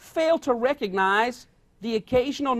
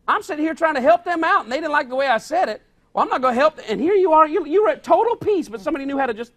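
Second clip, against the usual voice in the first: indignant, louder, higher rant; rising indignation; 5 semitones higher, 5 dB louder than usual. Retold or imitated. Offended listener working himself up, indignant. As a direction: get worked up as if stung by gossip. Getting worked up over gossip.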